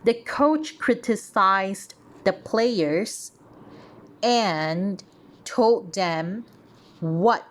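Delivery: slow, clearly read teacher voice; slow deliberate dictation; slow careful read. Shown as a demonstration. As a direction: read it out slowly, word by word. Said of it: "Let me read it out again".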